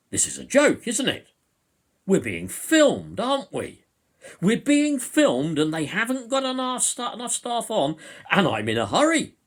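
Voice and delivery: loud, high-pitched mock complaint; deliberately out loud. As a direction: imitate an indignant man complaining so the whole queue hears. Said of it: "saying deliberately out loud".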